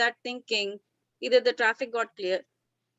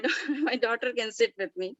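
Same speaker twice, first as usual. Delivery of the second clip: ordinary talk, nothing marked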